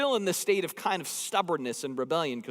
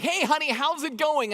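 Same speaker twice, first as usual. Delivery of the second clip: bright, high singsong hello to his wife; husband calling his wife, much higher and cheery; cheery husband greeting; 10 semitones higher, 4 dB louder than usual. Retold or imitated. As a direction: sing it up high like a chirpy husband. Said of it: "I'm like".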